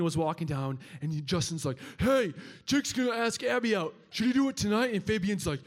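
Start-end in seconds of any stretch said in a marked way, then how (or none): 2.0-4.9 raised, higher call out the truck window. Retold or imitated. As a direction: hail a friend passing on the street. Justin calls out the truck window.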